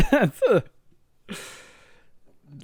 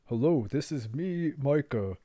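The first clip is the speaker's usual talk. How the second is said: put-on character voice as disguise; lightly disguised, mock-earnest introduction; fake real voice; 3 dB quieter than usual. Proving the voice isn't his, put-on character voice. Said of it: "And you just go like".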